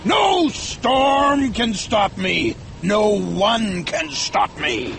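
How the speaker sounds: high, emphatic captain's rallying cry; rally the crew with fanatical force; defiant, commanding shout; fierce shout. A narrator's reading. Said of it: "shouted Ahab fiercely".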